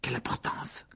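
unvoiced whispered retort. A narrator's reading. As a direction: whisper it with a shrug. Boys answer dismissively.

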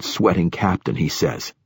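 usual speaking voice

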